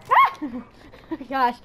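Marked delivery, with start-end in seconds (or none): 0.1-0.4 short, sharp scream of fright; startled scream. Real, not acted. Gamer who gets scared by the game, screaming. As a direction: yelp as if jumped. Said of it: "I'm screaming all over the place".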